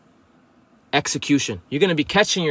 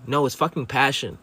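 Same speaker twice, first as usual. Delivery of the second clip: ordinary talk, nothing marked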